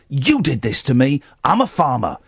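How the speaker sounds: ordinary delivery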